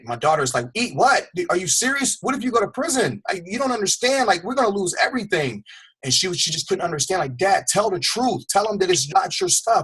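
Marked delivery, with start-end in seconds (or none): 0.7-5.6 put-on high, frantic teenage girl's pleading; panicked daughter. Daughter panics about father going to prison. 7.4-9.8 pleading daughter. Retold or imitated. Daughter begs father to confess.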